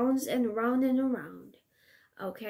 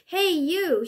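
high, loud call; shouted; 6 semitones higher, 4 dB louder than usual. Acted. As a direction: shout to stop the ogre. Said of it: "shouted Flynn".

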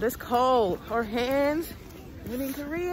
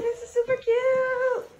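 higher, excited gushing; 11 semitones higher than usual. Real, not acted. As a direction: gush over the cute room. Gushing over room.

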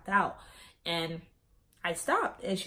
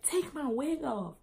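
retold demand; 7 semitones higher, slower than usual. Friend's demand in retold argument.

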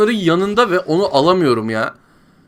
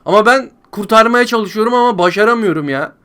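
ordinary talk, nothing marked

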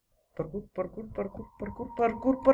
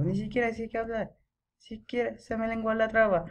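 ordinary talk

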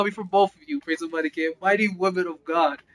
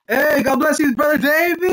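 loud, high-pitched greeting call; excited shout-out; 6 semitones higher, 3 dB louder than usual. Real, not acted. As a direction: call out a joyful blessing to a friend. Excited greeting to chat viewer.

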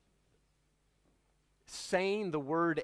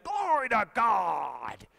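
raised, drawn-out cry of praise; excited shout; 5 semitones higher, 4 dB louder, much slower than usual. Retold or imitated. Imitating churchgoer interrupting announcements over answered prayer, excited shout. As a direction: burst out slowly with excited praise. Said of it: "he got excited about this answer to prayer".

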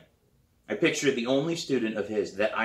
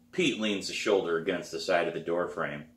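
ordinary delivery, nothing marked

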